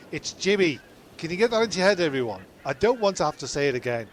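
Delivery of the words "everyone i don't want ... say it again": normal talking voice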